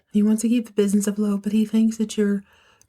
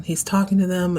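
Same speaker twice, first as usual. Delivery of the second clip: usual speaking voice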